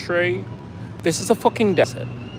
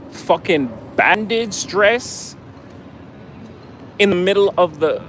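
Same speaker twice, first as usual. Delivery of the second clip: ordinary delivery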